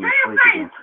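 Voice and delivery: teasing squeaky high-pitched voice; mock high voice. Teasing his sleepy wife in a squeaky high mock voice. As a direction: pitch it way up to poke fun.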